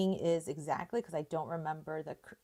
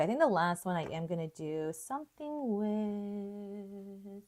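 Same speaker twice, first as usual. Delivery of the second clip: ordinary talk, nothing marked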